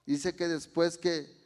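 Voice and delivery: loud, rising sermon climax; fervent preaching. Real, not acted. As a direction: preach it out with full conviction. Preaching at full force.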